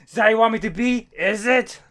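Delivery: loud, high angry roar; furious shout. Retold or imitated. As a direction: shout it at people who fear you. Plutonian raging, voiced by friend.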